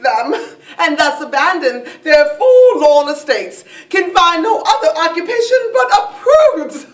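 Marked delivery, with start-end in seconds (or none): none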